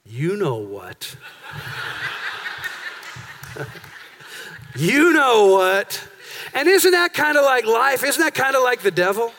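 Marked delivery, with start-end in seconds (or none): 0.1-1.1 low, slow, sneaky parrot; sly parrot voice. Retold or imitated. Parrot luring Pastor Tommy over, low and sly. 1.5-3.8 unvoiced snicker; a hushed wicked snicker; sneaky breathy cackle. Parrot snickering at Pastor Tommy, hushed cackle. Parrot laughing before insult. 4.7-6.0 slow and louder taunt. Retold or imitated. Parrot taunting Pastor Tommy, slow and louder.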